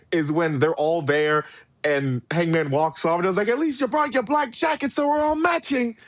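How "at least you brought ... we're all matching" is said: high-pitched put-on voice; mimicked wrestler. Wrestler calling after Hangman walking off, high mimicry. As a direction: push the voice way up in mimicry. Imitating wrestler's line from a scene.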